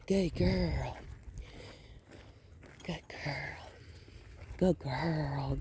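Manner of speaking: soft unvoiced praise to a dog; whispered praise. Real, not acted. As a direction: breathe the praise, barely voiced.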